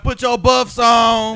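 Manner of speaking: loud, high, drawn-out taunt; stretched-out playful shout; mock hollering. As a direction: holler it slowly like egging someone on. Acting out hyped teasing.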